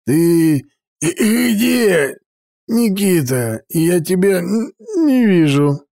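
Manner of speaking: put-on high, stammering drunk voice; giggly drunk. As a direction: stumble over words, too drunk to see. Не открывая опухших глаз.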